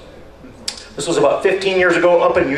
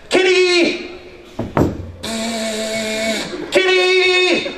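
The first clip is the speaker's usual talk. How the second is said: high, drawn-out call to a kitty; calling the cat; 11 semitones higher, much slower than usual. Retold or imitated. Man with a leaf thrower calling his cat out from under the car, high pleading call.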